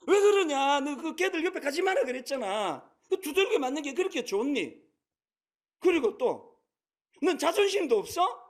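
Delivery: put-on sharp, loud, high scolding of a little girl; scolding mother. Big sister scolding her bullied little daughter, loud and sharp. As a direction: scold her for going back to the kids who hit her. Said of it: "막 야단을 친 거예요".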